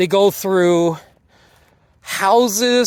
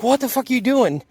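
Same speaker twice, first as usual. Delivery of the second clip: high, fast mock outrage; quick, pitched-up imitation of a shocked girl; retold alarmed outburst; 7 semitones higher, faster than usual. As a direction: blurt it out like a startled kid. Ellie handcuffed, retold outburst.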